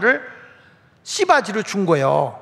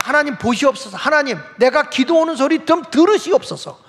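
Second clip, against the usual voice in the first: earnest pleading prayer; 6 semitones higher, 4 dB louder than usual. Acted. Worshipper bringing a written problem to God, earnest pleading. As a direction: implore God, stretching the last words out loud. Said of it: "간절히 기도하십시오".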